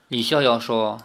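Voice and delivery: ordinary delivery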